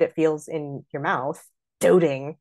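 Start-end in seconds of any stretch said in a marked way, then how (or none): 1.8-2.4 "doting" hit hard and louder on DOH; punched, stabby "doting"; little stab. Shown as a demonstration. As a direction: jab the first syllable of "doting". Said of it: "its own little stab to it".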